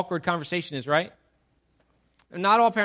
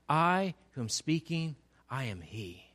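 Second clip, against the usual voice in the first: slow, much softer and lower; hushed revelation; 5 semitones lower, 6 dB quieter than usual. Acted. Jesus revealing himself to the woman, slow and hushed. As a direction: drop to a reverent hush for the reveal.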